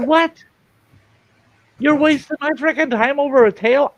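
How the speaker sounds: high, loud mock outrage; hammed-up disbelief at the cop; indignant shout. Indignant retort to deputy.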